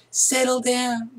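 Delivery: prim teacher hushing a class; teacher calming class. Shown as a demonstration. Teacher hushing a rowdy class, high and slow. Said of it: "teachers can't say quiet".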